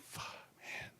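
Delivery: barely voiced curse; whispered disbelief; stunned whisper. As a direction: let it out under your breath, floored. His stunned reaction to being recognized.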